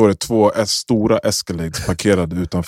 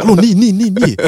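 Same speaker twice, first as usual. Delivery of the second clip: calling out; 11 semitones higher, 4 dB louder than usual. Retold or imitated. Girl calling out from sofa.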